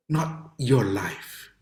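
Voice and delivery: slow hushed whisper; hushed, slow English aside. Real, not acted. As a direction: drop to a whisper for the punchline.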